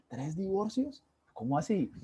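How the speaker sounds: incredulous, pitch jumping up on tres; disbelieving repeat of the news; surprised echo. Retold or imitated. Retelling his shock at the friend's three divorces, incredulous.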